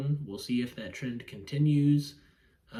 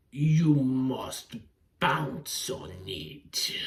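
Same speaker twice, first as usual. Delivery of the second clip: ominous voice; 4 dB louder, much slower than usual. Retold or imitated. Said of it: "a meme where it's like".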